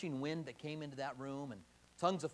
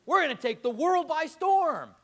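louder and much higher, brimming with confidence; overconfident excitement; 8 semitones higher, 10 dB louder than usual. Retold or imitated. Early church members boasting about easy success, excited and loud. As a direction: crow about the easy win ahead. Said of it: "I imagine those hundred twenty said".

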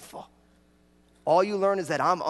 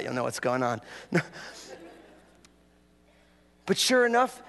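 normal talking voice

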